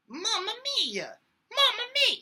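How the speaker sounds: very high falsetto mamma mia. Shown as a demonstration. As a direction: float it up in falsetto. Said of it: "there's the falsetto".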